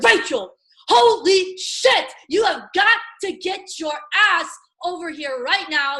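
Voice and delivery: the hyped-up phone call you should make; excited. Shown as a demonstration. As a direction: call your friend like you just struck gold. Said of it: "You're gonna be like".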